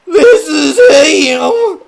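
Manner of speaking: high, loud sobbing cry. Acted. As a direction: wail it through sobs. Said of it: "cried the Reverend, sobbing".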